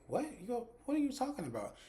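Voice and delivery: high-pitched, baffled questioning; incredulous. Retold or imitated. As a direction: imitate a stunned reaction. Woman baffled by caller's claim.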